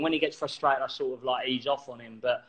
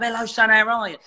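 cheery, higher-pitched landlord greeting; dad greeting customers; 5 semitones higher, 4 dB louder than usual. Acted. Publican dad greets people walking into the pub, cheery greeting. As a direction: call out a louder hello to a regular.

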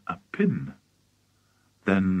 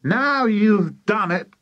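high, drawn-out scolding; high-pitched exasperated wife; accusing cry; 11 semitones higher, 4 dB louder, slower than usual. A narrator's reading. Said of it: "cried Missus Cranky".

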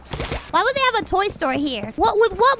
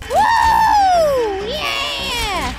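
loud, high whoop of victory; triumphant whoop; 10 semitones higher, 5 dB louder than usual. Real, not acted. Streamer cheers after cracking the puzzle, loud whoop. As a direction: celebrate the win out loud.

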